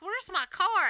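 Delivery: high puzzled cry of where's my car; confused. Retold or imitated. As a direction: sound lost and baffled. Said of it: "he's like".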